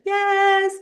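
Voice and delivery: high, loud cheer; a triumphant shout of yes; excited yes. Real, not acted. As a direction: celebrate the news. Cheering client's first paid gig.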